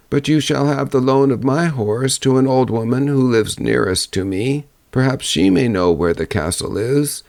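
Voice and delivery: put-on an old crone repeating the same directions, pitched high; second old woman. Second old woman lends the girl her horse, high old voice.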